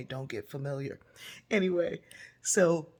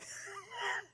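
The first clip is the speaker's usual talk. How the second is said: high squeaky giggle; a short high laugh after a joke; 6 dB quieter than usual. Real, not acted. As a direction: giggle at yourself.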